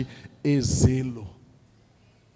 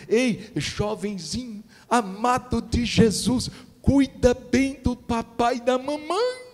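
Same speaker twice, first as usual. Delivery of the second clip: tender appeal; 9 semitones higher than usual. Acted. Preacher speaks tenderly to a youth.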